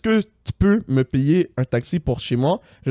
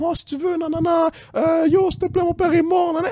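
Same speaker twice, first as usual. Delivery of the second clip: high, pleading imitation of her fake tears; mock crying girl; 12 semitones higher than usual. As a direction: beg in a high whiny voice. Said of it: "elle est comme". (imitation aiguë et suppliante)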